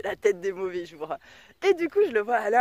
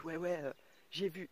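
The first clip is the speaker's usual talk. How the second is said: hushed reply; 5 semitones lower, 13 dB quieter than usual. Retold or imitated. Alain answering the son, hushed and low. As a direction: lower and much softer, a quick hushed yes.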